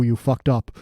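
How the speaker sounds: ordinary talk, nothing marked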